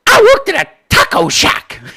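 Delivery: hammed-up high mocking voice of an outraged congresswoman; mock AOC outburst. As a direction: parody her indignant rant in a squeaky voice. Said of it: "She went nuts".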